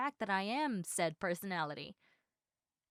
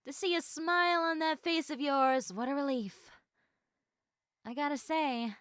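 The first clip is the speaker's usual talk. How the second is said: usual speaking voice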